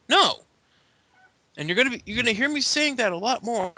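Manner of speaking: high, emphatic mock petulance; hammed-up indignant retort; defiant refusal. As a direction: refuse like a stubborn wrestling heel. Acts Daniel Bryan's defiant refusal.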